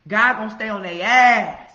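fired-up sermon line; loud declaration. Real, not acted. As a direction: bellow it as a warning. Emphatic preaching.

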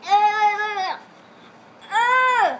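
loud grossed-out ew; disgusted groan. Real, not acted. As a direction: react to a foul taste. Tasting a bad jelly bean.

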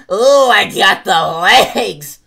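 loud, drawn-out brag; excited brag. Acted. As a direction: show off proudly. Showy repeat.

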